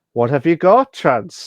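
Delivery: eager to see the food; excited curious question. Real, not acted.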